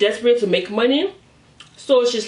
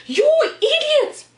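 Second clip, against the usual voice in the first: high, stretched-out furious yell at him; retold furious scream. Wife screaming at her cheating husband, furious high yell. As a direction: shriek it at him like a betrayed wife. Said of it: "She started screaming at him".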